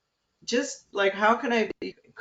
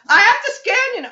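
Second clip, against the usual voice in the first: much higher and louder; loud announcement; 12 dB louder than usual. Retold or imitated. Assistant announcing the scan loudly, high and loud. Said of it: "she's like".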